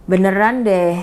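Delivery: usual speaking voice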